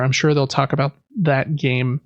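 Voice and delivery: ordinary talk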